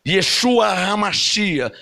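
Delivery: "Yeshua HaMashiach" said slowly in Hebrew; "Yeshua HaMashiach" spelled out with care; Hebrew phrase. Shown as a demonstration. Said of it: "Isso é hebraico".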